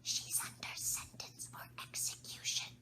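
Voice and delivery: breathless whisper of secret news; the Rabbit whispers into Alice's ear. A narrator's reading. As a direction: lean in and whisper it. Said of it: "and whispered".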